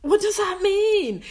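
high, slow, imitating baffled listeners; mock puzzled question. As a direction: play someone baffled by the claim. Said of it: "and they're just like".

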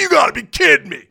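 loud high laughing outburst; you gotta be kidding laughing; laughing disbelief. Retold or imitated. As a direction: blurt it through a laugh. Retelling shocked reaction.

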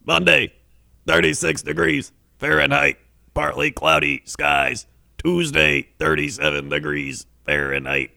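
measured announcer forecast read; weather forecast read. Acted.

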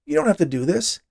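high, begging voice; pleading. A narrator's reading. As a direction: beg them to reconsider. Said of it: "he begged".

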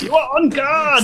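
high, hammed-up German bark; over-the-top mock German accent; atrocious German accent. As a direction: call it out like comic German radio police. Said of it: "the atrocious accents that [name] has been demonstrating".

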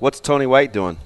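ordinary delivery

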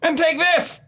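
high-pitched attack line; triumphant mid-fight taunt; battle cry. Acted. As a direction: call it out as the blow lands. Fighter's line while attacking in game.

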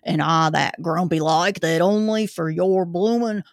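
put-on gruff old Cockney zookeeper; Cockney accent. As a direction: grumble it like a London keeper. Zookeeper reads in character.

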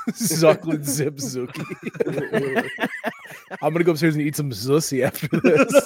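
giggling through silly made-up words; laughing. Real, not acted. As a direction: barely get it out for laughing. Cracking up over silly Z-words.

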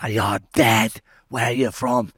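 mock yells from the cells; jeering prisoners calling out; retold shouting. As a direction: shout it like a taunting inmate. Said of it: "everyone shouts stuff at you".

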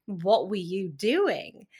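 loud, disbelieving rant; exasperated outburst. Real, not acted. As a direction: throw your hands up, loudly. Exasperated at her parents.

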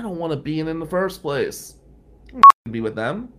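loud, fast, fed up; teen venting about the team; angry outburst. Retold or imitated. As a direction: blurt it out furious. Angry at being pushed out.

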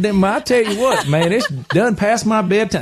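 exaggerated Southern accent, played up for an audience; laid-on Southern accent. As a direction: lay the twang on thick for laughs. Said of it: "And I started laying it on".

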